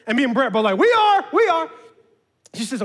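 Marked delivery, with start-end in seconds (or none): 0.8-1.7 high, loud eager reply; answering the driver in a hurry; eager shout. Retold or imitated. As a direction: jump at the offer.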